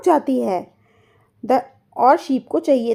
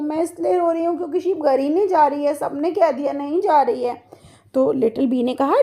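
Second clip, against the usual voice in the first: normal talking voice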